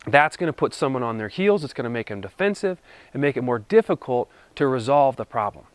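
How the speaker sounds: ordinary delivery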